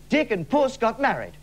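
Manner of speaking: ordinary delivery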